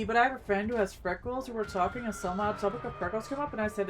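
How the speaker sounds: ordinary delivery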